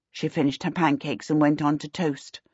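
ordinary delivery, nothing marked

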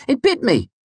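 high, loud startled protest; indignant and anxious. A narrator's reading. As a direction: yelp it, half offended, half scared. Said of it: "pitching my voice somewhere between indignation and anxiety".

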